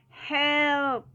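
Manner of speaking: loud, high cry for help; drawn-out, dramatic call. A narrator's reading. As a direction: yell in storybook alarm. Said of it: "cried Toad".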